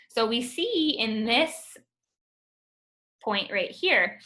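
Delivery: usual speaking voice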